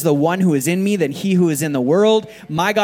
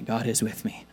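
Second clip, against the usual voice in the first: soft repeated affirmation; 5 semitones lower, 8 dB quieter than usual. Shown as a demonstration. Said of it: "Say it again".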